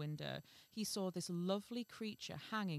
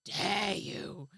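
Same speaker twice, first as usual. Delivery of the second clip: loud, drawn-out outrage; hammed-up offence; mock indignation; 8 dB louder, much slower than usual. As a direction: act affronted, leaving a beat mid-phrase. Acts out her offended comeback.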